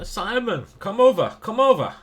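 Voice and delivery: put-on high, loud Russian accent calling out. Russian players call Simon over, in a loud Russian accent. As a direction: shout across the kebab shop in a Russian accent. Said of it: "in a Russian accent".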